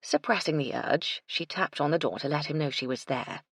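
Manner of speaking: plain reading voice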